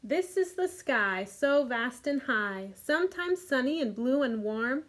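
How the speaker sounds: lively storybook read-aloud; reading to children. A narrator's reading. Teacher reading The Snail and the Whale to kids. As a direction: read it warmly to a group of little ones. Reads picture book aloud.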